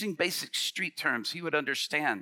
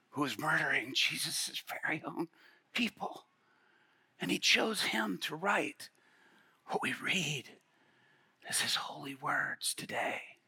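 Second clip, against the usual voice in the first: hushed emphasis; 5 dB quieter than usual. Real, not acted. Preacher to the inmate, hushed and intent. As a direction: lean in and hush the point. Preacher stressing Paul's past.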